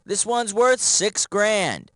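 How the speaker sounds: ordinary talk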